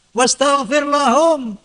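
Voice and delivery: slow chanted Arabic, lahum drawn out; Quranic recitation. Acted. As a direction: intone the verse reverently. Quran verse quoted again. (lantunan ayat perlahan)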